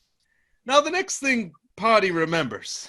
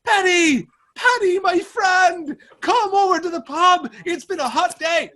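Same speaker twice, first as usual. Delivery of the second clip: high, hearty pub greeting; Sean calling out; 8 semitones higher than usual. Retold or imitated. Drunk Sean calling his friend to the pub. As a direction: beckon your friend over to the pub. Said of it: "and he goes".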